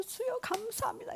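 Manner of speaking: high, soft, emotional thanks to the Lord; overcome with gratitude. Retold or imitated. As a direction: imitate someone moved to tears in prayer. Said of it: "막 감격해서". (감격에 찬 기도 흉내)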